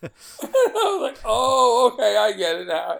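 high, loud laughing through the words; can barely get it out for laughing. Real, not acted. Son laughing about his father's rude Vietnamese.

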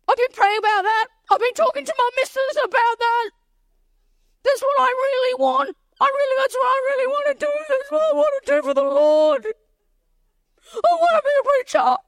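very high, tearful wail; hammed-up imitation of a sobbing man; mock crying. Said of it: "He starts crying".

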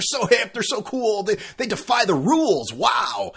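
mock-gushing, pitched high with stretched words; sarcastic admiration. Podcaster mocking Super Bowl hype about the Browns, sarcastic admiration. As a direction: gush sarcastically over the cool rebels.

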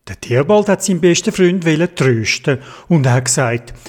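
ordinary delivery

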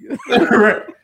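high, loud burst of laughter; real teasing laugh. Real, not acted. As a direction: crack up at a friend's slip. Teasing co-host about slurring.

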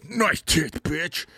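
hammed-up killer turkey taunt; put-on creature voice, dropping off on the last word. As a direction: play the villainous turkey's one-liner. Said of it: "and he goes".

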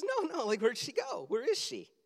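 high-pitched worried questioning; mock panic of a searching dad; alarmed father. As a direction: ask it high and anxious.

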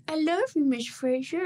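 mock little-kid voice. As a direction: play an adoring snotty pupil to the teacher. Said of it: "But they're like".

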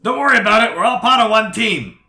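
high, very loud character holler; loud, high-pitched bird character yell; called-out shout. Acted. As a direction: holler it high across the yard. Bird calls out to the party.

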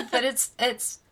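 helpless giggle through the words; laughing. Real, not acted. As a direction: break up laughing as you admit it. Laughing while admitting wrong pronunciation.

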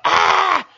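loud, high yell of "Ah"; imitated scream. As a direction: mimic a sudden scream blaring in headphones. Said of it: "deep screaming noises over my headphones, like, really loud".

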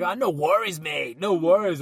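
lightly hammed-up Australian accent; put-on Aussie accent. Surfer giving them Aussie slang, imitated Australian accent. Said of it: "trying to put on his Aussie accent".

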